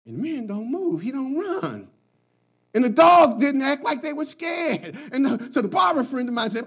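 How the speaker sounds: ordinary delivery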